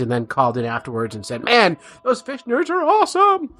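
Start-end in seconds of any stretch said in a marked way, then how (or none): 1.4-3.5 high-pitched mock fan gushing; excited caller. Imagined listener calling the station afterwards, hammed-up excitement. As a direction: gush like a thrilled radio caller.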